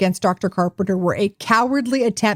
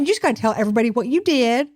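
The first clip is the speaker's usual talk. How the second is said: mock syrupy Southern DA; 4 semitones higher than usual.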